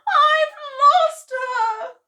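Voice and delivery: high, very slow crying wail; tearful wail. Acted. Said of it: "cried Missus Cranky".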